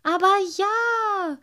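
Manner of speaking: high, loud, stretched little cry; insistent high merman call. A narrator's reading. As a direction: sing it back gleefully. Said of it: "rief der kleine Wassermann".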